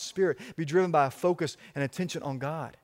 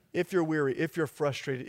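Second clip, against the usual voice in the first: usual speaking voice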